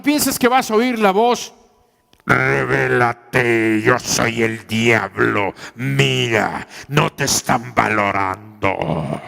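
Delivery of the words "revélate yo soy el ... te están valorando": deep, slow demonic devil voice; voice from beyond the grave. Acted. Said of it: "se oye así la voz de ultratumba".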